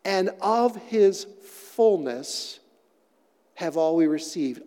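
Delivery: slow reverent reading of the verse. Shown as a demonstration. Pastor reading scripture aloud to the church, slow and reverent. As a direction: read it slowly, word by word. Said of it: "says it like this".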